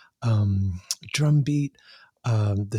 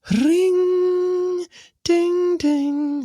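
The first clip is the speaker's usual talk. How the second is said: high, ringing synth notes; imitated keyboard entrance; 4 dB louder, much slower than usual. Guest mimicking a song's keyboard entrance, high ringing imitation. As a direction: sing out piercing keyboard notes, loud and sustained. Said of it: "And then the keyboard entrance".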